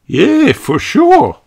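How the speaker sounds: high, slow cheery drawl; Australian dad voice. A narrator's reading. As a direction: stretch it out, easygoing Aussie. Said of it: "said Mister Kangaroo".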